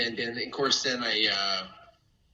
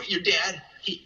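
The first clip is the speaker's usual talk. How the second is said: ordinary delivery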